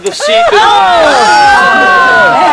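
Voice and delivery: straining, drawn out. Acted. Climbing onto toilet seat.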